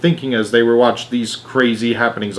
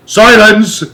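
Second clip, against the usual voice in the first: loud, high order for silence; commanding shout; 10 semitones higher, 9 dB louder than usual. A narrator's reading. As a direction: cut them off hard. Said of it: "said the President".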